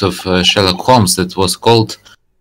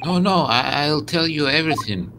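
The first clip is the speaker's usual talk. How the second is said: higher, softer pleading voice; frightened suspect; 8 semitones higher, 8 dB quieter than usual. Acted. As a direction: give in fearfully, pitched up and quiet. Acted suspect giving in to threat.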